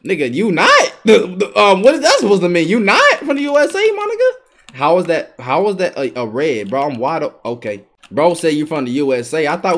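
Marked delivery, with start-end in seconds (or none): 1.8-4.8 high outraged question; disbelieving shout; incredulous. Real, not acted.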